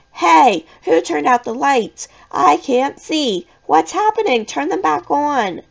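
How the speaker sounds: high, urgent calling out; alarmed kids calling out, pitched high; shouting kids. A narrator's reading. Kids in a sudden blackout, shouting for the lights. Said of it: "Kids started to shout".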